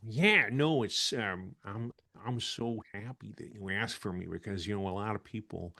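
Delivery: soft, laid-back celebrity impression; Owen Wilson. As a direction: trail off softly in an amiable movie-star voice. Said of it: "I wanna hear from Owen Wilson".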